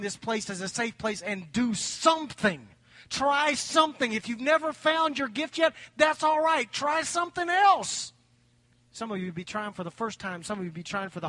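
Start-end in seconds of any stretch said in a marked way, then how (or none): 3.1-8.1 loud, high rallying cry; rousing pulpit exhortation; impassioned preaching. Real, not acted. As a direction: urge them on, voice raised. Urges congregation at full force.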